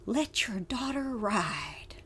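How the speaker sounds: real choked-up reading, slow and hushed. Real, not acted. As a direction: let it fade to a slow near-whisper, moved by the words. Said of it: "I'm getting choked up reading that".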